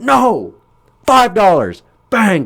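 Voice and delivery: high loud no, then bang; mock shout. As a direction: shout and smash. Smashing with bat.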